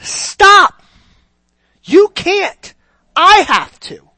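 high, loud, emphatic command; acted yell. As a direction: yell it as Jesus halting an overeager follower. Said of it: "Jesus is yelling at you".

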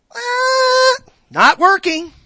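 high mock buzzer noise then a loud verdict. Preacher rejecting the excuse, a loud mock buzzer. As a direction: buzz them out like a game show.

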